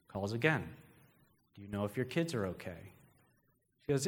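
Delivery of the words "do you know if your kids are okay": low, hushed menacing phone voice; breathy creepy caller. Acted. Creepy caller phoning the babysitter, breathy and low. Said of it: "It's a breathy voice".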